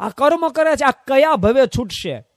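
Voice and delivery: high, loud and slow reproach; mocking disapproval, drawn out; scornful scolding. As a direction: scold them like a self-righteous onlooker. Jain scolding the monks.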